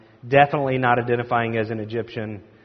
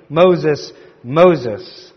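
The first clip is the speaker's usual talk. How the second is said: lightly voiced God calling out, louder; God calling by name; 3 semitones higher, 7 dB louder than usual. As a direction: call the name twice, each firmly set apart. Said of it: "God calls him by name".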